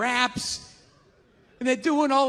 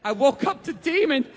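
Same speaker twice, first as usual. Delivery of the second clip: ordinary talk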